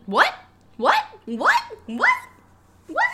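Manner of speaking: repeated short rising questions; baffled. Real, not acted. Co-host reacting to a bizarre review line, incredulous. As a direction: keep asking in rising disbelief.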